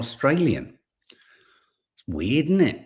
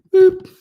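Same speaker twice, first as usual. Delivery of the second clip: very high, loud little boop; high-pitched imitated beep; playful boop; 14 dB louder than usual. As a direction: squeak out a short button-press "boop". Imitated beep while popping note back.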